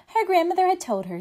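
quick, lifted refrain; brisk refrain. A narrator's reading. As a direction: rattle off the familiar refrain. Repeated refrain read to children.

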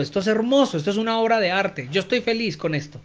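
usual speaking voice

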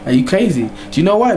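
normal talking voice

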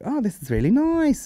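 high-pitched mock delight; put-on high voice, enjoying herself; pleased tipsy comedian. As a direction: imitate her cooing with pleasure, high.

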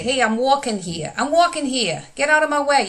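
put-on a New Yorker shouting at someone blocking the way in a New York accent. Teacher mimics an annoyed New Yorker in a New York accent. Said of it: "Did you miss my accents?".

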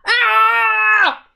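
high, loud falling howl; troll howl. Acted. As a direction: howl as the river sweeps you away. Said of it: "howled the troll".

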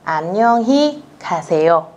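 very slow, syllable by syllable Korean; slow model reading. Shown as a demonstration. Teacher reading slowly to students. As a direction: spell it out slowly for learners. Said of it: "các bạn đọc là".

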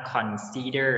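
consider said slowly as "khon-si-der", the first syllable "khon" clearly aspirated; correct pronunciation. Shown as a demonstration. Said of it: "คำนี้อ่านว่า consider นะลูก". (ออกเสียง consider ช้าๆ ชัดๆ ว่า "คอน-ซิ-เดอร์")

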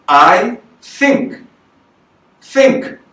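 "think" pushed out loud from the belly, th breathy and strong. Shown as a demonstration. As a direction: project "think" with diaphragm support. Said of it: "こうやってお腹を動かすことによって響き".

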